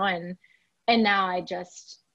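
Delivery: ordinary talk, nothing marked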